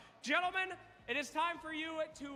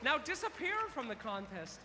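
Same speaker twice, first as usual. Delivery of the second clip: ordinary talk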